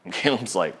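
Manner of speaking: normal talking voice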